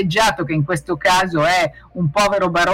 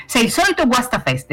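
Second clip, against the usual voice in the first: mild, higher-pitched motherly protest; 7 semitones higher than usual. A narrator's reading. Mamma protests to papà about his party-pooping, mild protest. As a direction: complain in a raised pitch, lightly acted. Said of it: "protestò la mamma".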